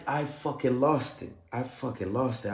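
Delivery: normal talking voice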